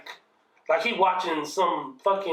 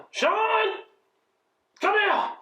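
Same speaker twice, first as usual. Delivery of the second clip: mother calling; much slower than usual. Retold or imitated. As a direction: call the boy like he's in trouble. Mother summons him from her room.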